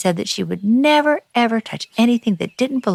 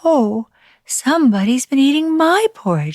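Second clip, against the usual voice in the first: higher, lighter mother bear voice; a fussy mama bear, pitched up; 8 semitones higher, 4 dB louder than usual. A narrator's reading.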